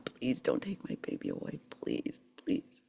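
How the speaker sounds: soft, near-whispered plea; hushed begging prayer. Retold or imitated. Mother begging God over her daughter, hushed pleading. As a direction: beg quietly, voice fading almost to nothing. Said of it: "I've just been begging God".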